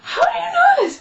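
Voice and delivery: very high squeal of amazement; squealing disbelief. Retold or imitated. Retelling her shock that he knows the school, high squeal. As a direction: squeak it out in astonished delight. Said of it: "And I was like".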